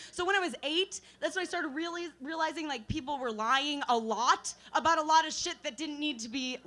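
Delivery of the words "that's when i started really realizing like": usual speaking voice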